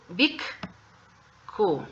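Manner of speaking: "big Q" with a clear stop between; pause in big Q. Shown as a demonstration. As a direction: break "big" and "Q" with a short beat. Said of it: "aquí sí se va a hacer una pausa".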